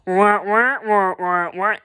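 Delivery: muted trombone wah-wah like Peanuts adults. Retold or imitated. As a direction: Peanuts grown-up gibberish, trailing off. Grandma voice again.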